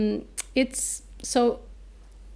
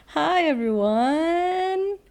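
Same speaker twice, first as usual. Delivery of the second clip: high drawn-out welcome; 7 semitones higher, 6 dB louder, slower than usual. Acted. Host greets listeners drawn out.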